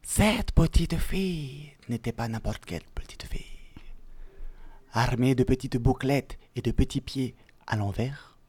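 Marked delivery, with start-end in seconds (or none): 0.0-3.5 mysterious whisper. Acted. Storyteller builds mystery.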